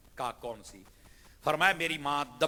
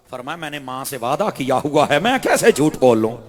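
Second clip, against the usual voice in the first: tag; 14 dB louder than usual. Acted. Boy's firm answer, loud.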